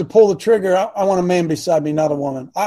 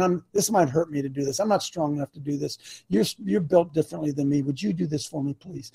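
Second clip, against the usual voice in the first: soft pleading wife; 4 semitones lower, 8 dB quieter than usual. Retold or imitated. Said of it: "She's saying".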